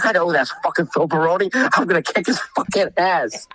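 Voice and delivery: high-pitched, worked-up reenactment; retold defiant anger. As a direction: act out a defiant threat to the guards. Retold furious reply to guards.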